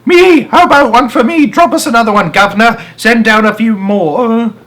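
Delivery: very high, loud clamouring; crowd calling out. A narrator's reading. Crowd under the balcony calling up for money. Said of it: "started calling out".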